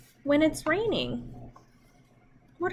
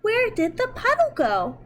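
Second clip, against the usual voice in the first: mock puzzled; 7 semitones higher, 3 dB louder than usual. Puzzled question to children.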